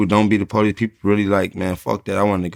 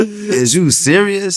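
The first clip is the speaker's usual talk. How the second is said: high, slow, laughing disbelief; mock outrage; 12 semitones higher, 4 dB louder, much slower than usual. Retelling tourists touching buildings, high laughing outrage. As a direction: play it up, laughing at the absurdity. Acting out his reaction to tourists.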